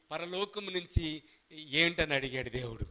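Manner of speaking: usual speaking voice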